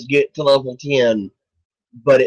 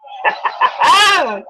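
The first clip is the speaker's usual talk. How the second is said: high happy laugh; delighted laugh. Real, not acted. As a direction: laugh with glee. Laughing after the win.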